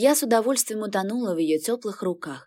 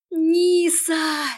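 high call from afar; 9 semitones higher, 4 dB louder than usual. Acted. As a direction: call her name across the street. Sister calls across the street.

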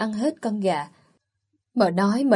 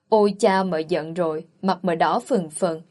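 ordinary delivery

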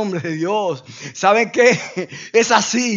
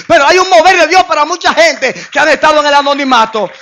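louder, higher preaching cry; preaching at full force; 7 semitones higher, 7 dB louder than usual. Acted. Preacher lifting up the unknown ones, louder and higher. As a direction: drive it home to the crowd.

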